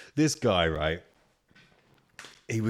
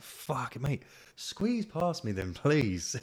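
higher, drawn-out mock exasperation; exasperated; 7 semitones higher, much slower than usual. Passenger telling man to squeeze past, exasperated. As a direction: plead in retold exasperation, stretching the words.

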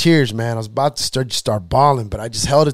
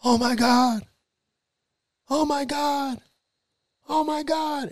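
retold stunned repeating; 12 semitones higher, 5 dB quieter than usual. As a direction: repeat it softly in a high voice, imitating her. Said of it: "she just kept saying that".

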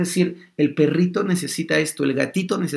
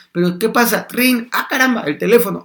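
higher and louder, caught off guard; startled mother; 5 semitones higher, 4 dB louder than usual. Retold or imitated. Mother startled as the phone rings, higher and louder. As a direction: jump as the phone suddenly rings.

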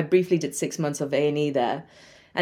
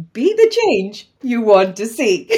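proverb said with a laugh, a bit louder; laughing punchline; 4 semitones higher, 4 dB louder than usual. Shown as a demonstration. Joking that the proverb is a housework trick, laughing. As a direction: deliver the motto as a cheeky joke. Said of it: "as a life hack".

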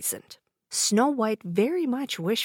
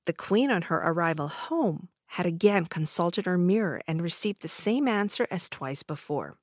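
plain reading voice

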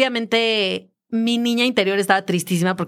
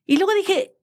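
normal talking voice

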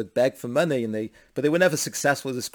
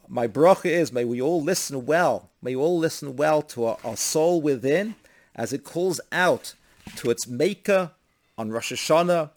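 raised, emphatic benediction; preached blessing; 3 semitones higher, 4 dB louder than usual. Real, not acted. Rabbi blesses listeners before Rosh Hashanah, emphatic preaching. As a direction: proclaim the blessing in deliberate phrases.

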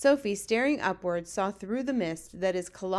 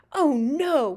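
loud, higher cry of dismay; 6 semitones higher, 7 dB louder than usual. A narrator's reading. Giant hears what is in the jar, dismayed cry. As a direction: cry out at a horrible discovery. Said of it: "he cried".

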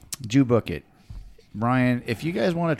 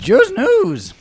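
high, loud jingle call; announcer voice; 6 dB louder than usual. Acted. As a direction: sing out the show name. Announcing the segment name.

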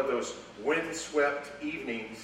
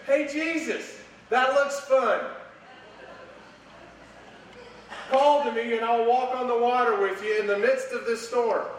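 much higher and louder, thrilled; eager request; 9 semitones higher, 5 dB louder than usual. Pretend. Preacher voicing Peter asking to walk on water, excited and high. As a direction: ask Jesus with delighted daring. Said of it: "the last of which would have been".

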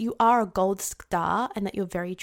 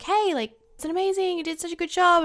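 gushing praise; 7 semitones higher, faster than usual. Acted. Acting out praise among women.

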